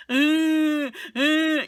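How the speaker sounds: high, loud whiny grunts imitating a fussy child; mock kid whine. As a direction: ham up a spoiled kid's fake cry. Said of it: "They're going".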